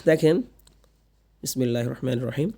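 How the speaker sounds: ordinary talk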